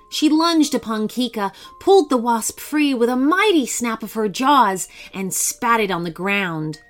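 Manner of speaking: ordinary delivery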